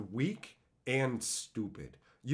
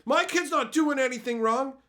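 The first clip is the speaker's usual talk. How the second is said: high, loud indignant parent; mock parent yelling; 11 semitones higher, 6 dB louder than usual. Parent yelling at teachers, mock outraged. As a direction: yell it as an outraged parent, pitched way up. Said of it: "come in and yell at the teachers".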